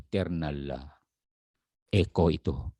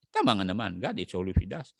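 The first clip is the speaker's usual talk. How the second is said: ordinary talk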